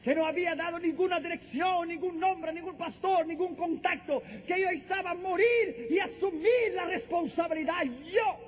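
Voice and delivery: high, insistent preacher's delivery; impassioned preaching. Real, not acted. Preacher testifying he was ready to die, impassioned. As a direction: build each phrase high and forceful.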